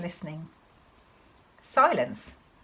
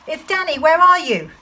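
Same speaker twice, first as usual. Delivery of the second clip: high, loud searching shout; shouted call; 10 semitones higher, 10 dB louder than usual. Acted. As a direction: call out to locate dad. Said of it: "I shouted".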